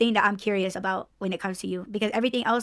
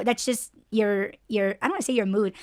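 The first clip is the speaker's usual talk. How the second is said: usual speaking voice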